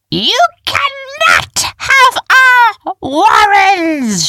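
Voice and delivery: very high, slow, drawn-out yell; defiant shout. A narrator's reading. Heroine defying the approaching diggers, slow, high shout. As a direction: stretch every word at full volume. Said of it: "she shouted".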